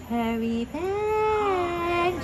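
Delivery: slow, quiet, eerie drawn-out farewell; creepy Constance. Retold or imitated. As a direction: stretch it out slow and spooky. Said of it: "She's all creepy and scary".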